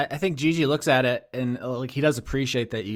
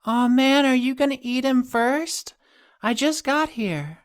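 put-on bright, childlike pitched-up whine; high playful voice; 13 semitones higher, slower than usual. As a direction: whine like a hungry kid who's late. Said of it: "you hear a voice".

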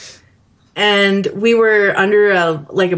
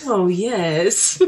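a softer, lightly deepened lounge voice; smooth deep DJ; 2 semitones lower, 4 dB quieter than usual. Retold or imitated. Fan imitating the radio DJ after his confidence boost, smooth and soft. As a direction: purr it like a confident radio host. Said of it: "His voice deepens".

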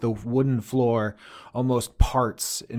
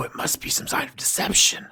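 whisper; 7 semitones higher than usual. Acted. Heads conferring secretly.

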